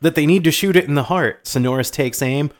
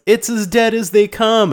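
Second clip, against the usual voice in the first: higher, brash boast; confident scoff, pitched up; cocky cop; 8 semitones higher, 4 dB louder than usual. Retold or imitated. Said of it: "the partner says".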